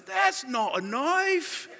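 slow, high-pitched Australian movie-hero imitation; playful quote of an Aussie film line; Nick Dundee. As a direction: draw it out as the unimpressed bushman. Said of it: "All of a sudden he goes".

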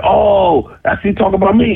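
high, retold dawning indignation; stung realization. Rapper hearing the diss lyric, stung realization. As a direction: realize the jab is meant for you.

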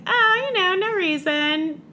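high, coy dodge, trailing slowly; mock innocence. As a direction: play innocent while rummaging. [name] coyly dodging the question.

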